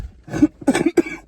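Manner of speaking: harsh smoker's cough. Real, not acted.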